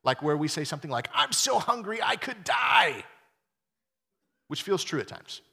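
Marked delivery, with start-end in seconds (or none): 1.1-3.0 loud, high, drawn-out hyperbole; over-the-top exaggerated whine. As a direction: wail it like a mock starving drama queen. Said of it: "we all exaggerate at times".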